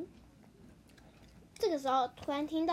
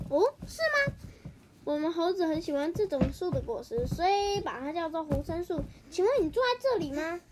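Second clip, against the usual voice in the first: high, louder bright-voiced mother monkey; 3 semitones higher, 4 dB louder than usual. Mother monkey politely questions grandpa mouse, high mother voice. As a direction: sweetly ask the old mouse.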